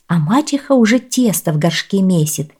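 usual speaking voice

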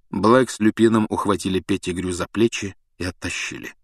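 ordinary delivery

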